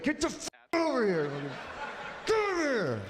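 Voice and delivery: high-pitched, hammed-up yelling; strained, high comic holler; mock yell at kid. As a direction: yank a runaway back with your voice. Yanking back runaway kid on leash.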